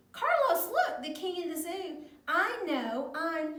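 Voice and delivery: high, loud storybook outburst; big hammed-up shock for young listeners; outraged surprise. A narrator's reading. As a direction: blurt it out like an indignant character. Shocked reaction to rival king in story.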